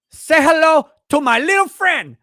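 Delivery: loud, high, hammed-up movie line; Scarface quote. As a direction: go full gangster movie. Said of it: "I'll be like".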